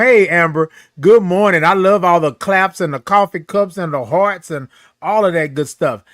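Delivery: ordinary talk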